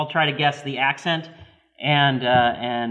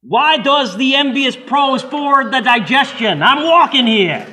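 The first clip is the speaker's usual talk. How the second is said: put-on loud, higher brash New York accent; New Yorker; 10 semitones higher, 9 dB louder than usual. Host's turn in the guessing game, loud New York accent. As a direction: shout it like a New York cabbie. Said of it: "A New Yorker".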